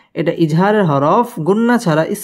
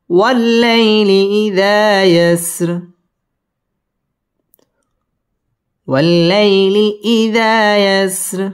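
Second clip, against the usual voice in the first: drawn-out melodic chant; slow Quran recitation; 4 semitones higher, much slower than usual. Shown as a demonstration. Teacher recites the verse for students. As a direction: recite it slowly, stopping at the end.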